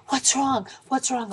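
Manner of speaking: high-pitched anxious questions, re-enacted; retold panic. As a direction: act out rushing up in alarm. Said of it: "my hands are shaking".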